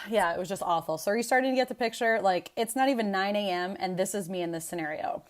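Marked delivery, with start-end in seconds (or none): none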